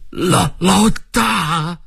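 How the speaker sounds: put-on a shocked, halting call to an old boss; high-pitched stutter of disbelief; stunned, bewildered cry. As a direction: stammer it out high and very slowly. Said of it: "又是惊讶又是茫然地叫了一声".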